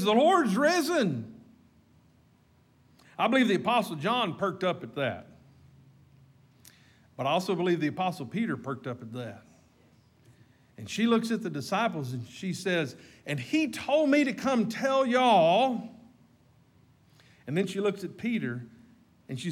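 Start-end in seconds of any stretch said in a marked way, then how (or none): 0.0-1.4 higher, louder, slow joyful proclamation; Mary bursting in with joyful news; excited announcement. Acted. 13.3-15.9 high, loud, slow emphatic news; Mary pressing the news on the disciples; excited announcement. Acted. Mary telling disciples her news.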